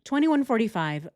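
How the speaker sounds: usual speaking voice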